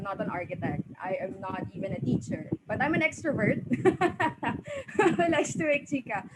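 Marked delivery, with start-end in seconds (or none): 2.7-6.2 giggling through the words; laughing while joking. Real, not acted. Panelist laughing at her own joke while talking. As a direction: laugh as you confess it. Jokes about being an extrovert.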